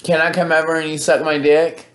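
ordinary talk

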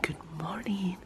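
soft early-morning whisper; quiet at night. Real, not acted. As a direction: whisper so nobody wakes. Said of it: "I'm talking very low because it's very early".

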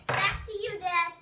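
show host hand-off. Pretend. Kid repeats hand-off line.

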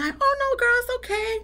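very high, sweet-talking friend voice; mock-forgiving girlfriend in a high pitch. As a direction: mimic an easygoing friend way up high. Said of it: "some friends, they, they say".

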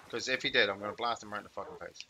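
normal talking voice

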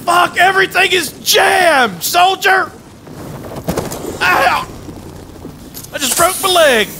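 ordinary talk, nothing marked

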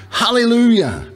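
mild narrated cry of praise; restrained shout. A narrator's reading. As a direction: lift the pitch high as if shouting, without full volume.

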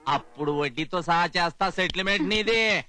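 normal talking voice

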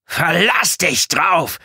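high, loud, slow shouted threat; drawn-out furious shout; angry yell. A narrator's reading. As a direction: yell it out in fury. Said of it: "schrie Bedford".

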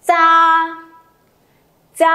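high, loud "Cha", held level; model syllable drill. Shown as a demonstration. Teacher reads twice for students to repeat, careful drill pronunciation. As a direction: sustain "Cha" on an even tone, pausing between repeats. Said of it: "Mỗi âm [name] sẽ đọc hai lần".

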